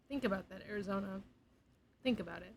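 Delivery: soft, hushed aside; quiet. Shown as a demonstration. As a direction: murmur it to the audience. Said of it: "I'm talking so quietly".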